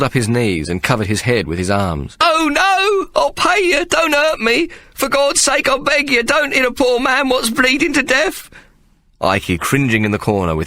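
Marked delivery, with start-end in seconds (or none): 2.2-8.5 very high, crying, desperate begging; a terrified coward crying for mercy; sobbing plea. A narrator's reading. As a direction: beg for your life through tears. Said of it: "Ikey, sobbing".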